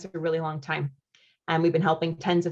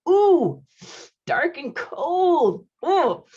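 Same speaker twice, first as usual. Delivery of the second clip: high drawn-out ooh, dark and cold slowly stretched; mock shiver; 10 semitones higher, 5 dB louder, much slower than usual. Real, not acted. Host reacting to a viewer in cold Toronto, playful drawn-out ooh. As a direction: savour the chill with a dramatic ooh.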